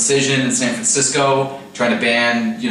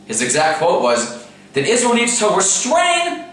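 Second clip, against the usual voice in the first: fake Chinese accent; 9 semitones higher than usual. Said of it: "do my fake Chinese impression".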